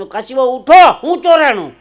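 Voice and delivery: loud, high call to a sleeper; urgent waking call. Acted. Waking Kashiba to ask what was stolen, loud and urgent.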